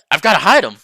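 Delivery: suddenly frantic to hide him; a rush of alarm; panicked. Acted. Panicking about hidden tiger.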